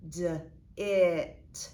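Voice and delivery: D as "duh", then a loud, stretched "it"; D as a letter sound, "it" punched out. Shown as a demonstration. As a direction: segment it: "duh", pause, "it" with short i. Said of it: "the I makes a short sound".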